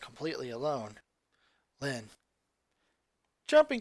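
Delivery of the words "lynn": soft creepy call. Acted. Sister sneaks up quietly.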